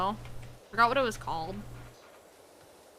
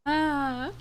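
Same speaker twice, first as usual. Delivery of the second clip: ordinary delivery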